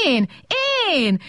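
short, mock squeals; squealing. A narrator's reading. Little robot charging at the big one, squealing. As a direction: squeal each word on its own, half in earnest. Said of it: "sikoltozott a kis robot".

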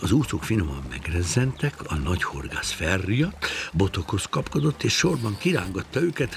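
ordinary delivery

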